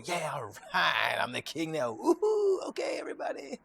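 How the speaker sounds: squealing, high-pitched mock cheer of a new king; mock gloating king. The teacher mocks the king gloating, squealing cheer. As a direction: ham up a power-drunk king celebrating.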